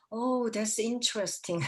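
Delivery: slow, drawn-out Oh, that's interesting; slow mock-admiring English; professor's slow "interesting". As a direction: savour each word like a polite professor. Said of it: "이렇게 말씀하세요".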